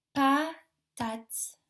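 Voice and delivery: PATATE as pa, pause, tate; split into syllables. Shown as a demonstration. Teacher gives children a word, PATATE split in two syllables. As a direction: break PATATE into two separate beats. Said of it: "dans la première syllabe".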